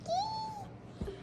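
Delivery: very high, soft kee; a thin hawk cry; hawk's scream. Acted. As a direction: hawk screeching over the farm.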